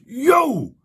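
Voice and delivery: a sudden high, hammed-up yell at a stranger; retold angry yell. Heckled man confronts the comic outside the club, angry yell. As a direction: bark it out like a guy picking a fight. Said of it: "he starts swearing at him".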